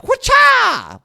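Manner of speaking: high, loud martial-arts yell; karate shout. Acted. Storyteller acting out the tree chop with a karate yell. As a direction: chop the air with a kung-fu cry.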